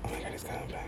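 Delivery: urgent hushed whisper. Real, not acted. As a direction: whisper fast before he hears. He walks by again.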